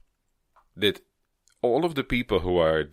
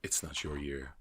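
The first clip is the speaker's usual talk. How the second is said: low, soft mock letdown with long pauses; imitated judge's rejection; 5 semitones lower, 10 dB quieter than usual. Judge turning down a girl, imitated soft low voice. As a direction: drop it low and quiet, pausing before the blow. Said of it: "He's like".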